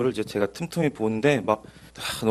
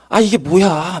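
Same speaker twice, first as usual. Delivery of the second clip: baffled exclamation; 7 semitones higher, 6 dB louder, slower than usual. Retold or imitated. Reader baffled by the Bible.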